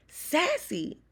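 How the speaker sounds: put-on high sharp call of the girl's name. Teacher calling out to the clumsy student, high and loud. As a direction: call her out across the room. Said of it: "she called out".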